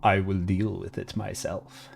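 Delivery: low, quiet regal queen; deep queen voice. Shown as a demonstration. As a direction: speak down low like a dark queen. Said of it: "Although she's a queen".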